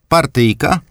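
high grandmother's voice asking; grandma voice. A narrator's reading. Grandmother asks her grandson about a card game, voiced high. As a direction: perk up at the idea of a game.

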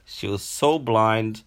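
ordinary delivery

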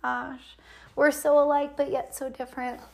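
quiet mock agreement to husband; soft sarcastic reply. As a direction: murmur it with playful irony. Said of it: "I was like".

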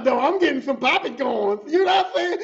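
chuckling through the words; laughing. Real, not acted. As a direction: laugh as you picture the fight. Laughs about lunchtime fight.

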